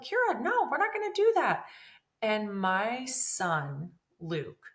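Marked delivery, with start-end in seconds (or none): 0.0-1.7 high, loud warning to her daughter; firm mom scold. Retold or imitated. Mother telling daughter Kira no, loud and high. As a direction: stop the kids sharply, loud and high.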